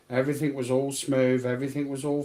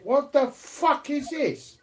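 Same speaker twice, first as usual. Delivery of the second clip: very high, drawn-out exclamation; incredulous outburst; 11 semitones higher, slower than usual. Retold or imitated. Twelve-year-old reacting to turbulence, incredulous. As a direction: relive the shock, slow and high-pitched.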